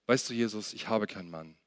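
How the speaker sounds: low, soft, understated quote; subdued admission. Acted. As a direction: admit it quietly, voice dropping away. Woman admits having no husband to Jesus.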